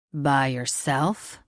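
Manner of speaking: slow, lingering, insinuating; creepy slow question. A narrator's reading. Richter eyeing Ilea, slow creepy insinuation. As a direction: draw it out with a leer. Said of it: "another long, creepy look".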